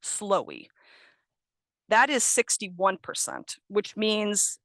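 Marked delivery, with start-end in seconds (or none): 0.0-0.7 "slowy", snowy with an L for the N, lower; student mispronunciation. Retold or imitated. Teacher repeating a student's slip, "slowy" with L for N. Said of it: "mispronouncing it as".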